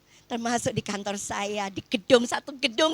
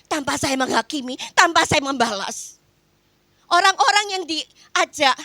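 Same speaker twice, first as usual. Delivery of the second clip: ordinary talk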